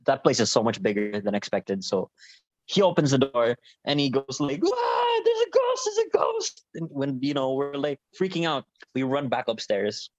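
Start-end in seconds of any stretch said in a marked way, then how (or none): 4.6-6.6 very high-pitched imitation of a terrified friend; panicked yell. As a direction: squeal it in mock panic. Friend opens door, sees a ghost.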